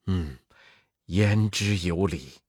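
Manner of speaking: put-on sage old monk. Monk Tang agrees.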